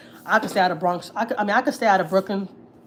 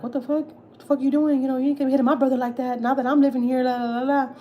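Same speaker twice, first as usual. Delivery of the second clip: much higher, fast heated challenge; retold angry confrontation; 6 semitones higher than usual. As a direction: get in his face for your little brother. Husband confronting the abuser.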